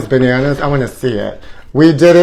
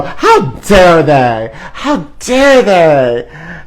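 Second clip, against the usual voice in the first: slow, drawn-out mock scandal; mock outrage; much slower than usual. Host mocking outraged critics, slow and theatrical. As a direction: gasp it like a clutched-pearls auntie.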